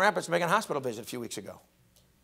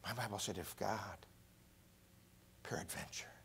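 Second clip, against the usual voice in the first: whispering; 7 semitones lower, 21 dB quieter than usual. Acted. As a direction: whisper the verse reverently. Scripture whispered.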